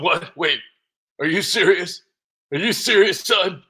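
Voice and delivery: high, loud disbelief; can't believe it, are you serious; amazed dad. Retold or imitated.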